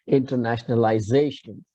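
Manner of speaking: internationalization for repeating, accent on "za"; stress on penultimate ZA. Shown as a demonstration. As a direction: lean into "za" in internationalization, falling on "tion". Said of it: "can you please repeat".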